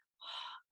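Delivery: long breathy sigh; heavy sigh. Acted. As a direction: sigh with regret. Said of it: "sighed Mister Wonka".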